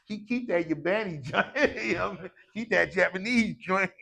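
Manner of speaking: joking through real laughter; amused, laughter breaking into the words; laughing. Real, not acted. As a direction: crack up while teasing. Joking about cheap watches, laughing.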